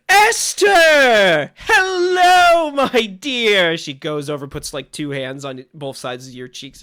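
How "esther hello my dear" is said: very high loud joyful greeting; delighted cry. Acted. Franny thrilled to see Esther at the door. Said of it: "opens the door".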